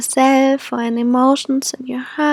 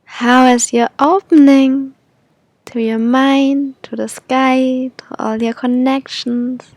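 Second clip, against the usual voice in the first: airy breathy mystical healer. Acted. Leads a guided meditation.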